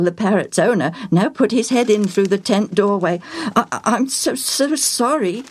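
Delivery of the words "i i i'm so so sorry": put-on high-pitched stammering boy; spluttering. A narrator's reading. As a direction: stumble over a flustered apology. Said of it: "Joel spluttered".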